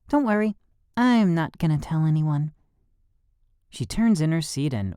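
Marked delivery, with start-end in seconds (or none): 0.1-2.5 put-on girl voice. A narrator's reading. Girl's voice.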